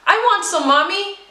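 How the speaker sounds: put-on a small girl begging for soup; eager child. A narrator's reading. Little Anna calling to her mother for soup, high excited child. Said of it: "Anna exclaims".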